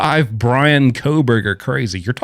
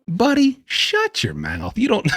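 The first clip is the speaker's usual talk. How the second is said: exasperated scolding; 11 semitones higher than usual. Real, not acted. Scolding the old man.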